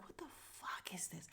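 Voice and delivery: whispered what the fuck is this; incredulous whisper. Retold or imitated. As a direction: whisper it in disbelief. Reacting to boyfriend's excuse.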